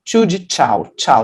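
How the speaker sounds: crisp CH at the start of "Tchu" and "tchau"; tch sound. Shown as a demonstration. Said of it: "Você não faz o tch". ("Tchu" com o som de tch de "tchau")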